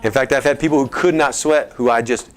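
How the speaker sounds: usual speaking voice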